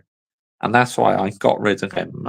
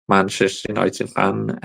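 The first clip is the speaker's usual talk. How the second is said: ordinary talk, nothing marked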